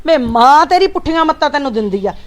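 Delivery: mother shouting at her son; furious scolding. Acted. Mother's quarrel peaks. (ਗੁੱਸੇ ਨਾਲ ਚੀਕਦੀ ਮਾਂ)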